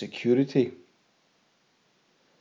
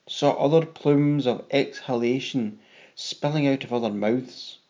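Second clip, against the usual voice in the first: ordinary delivery, nothing marked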